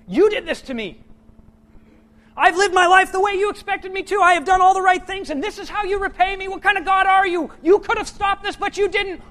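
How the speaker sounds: high, loud accusing shout at God; angry at God. Retold or imitated. A sufferer yelling accusations at God, furious. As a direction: rage at heaven for betrayal. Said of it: "they get mad at God".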